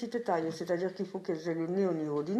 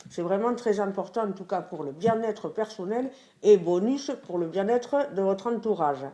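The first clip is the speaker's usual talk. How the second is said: usual speaking voice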